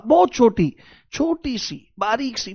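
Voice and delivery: a tiny, fading voice; soft, thin voice. Shown as a demonstration. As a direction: shrink the voice to show something tiny.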